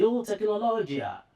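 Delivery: mocking exclamation. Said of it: "they laugh about it and they say".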